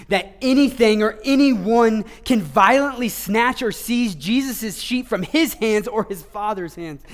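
preacher declaring the sheep secure, forceful; forceful preaching. Acted. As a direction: declare it weightily.

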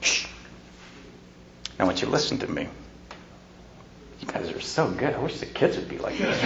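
put-on parent calmly addressing quieted kids, soft; quiet and firm. Shown as a demonstration. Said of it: "more forceful in some ways".

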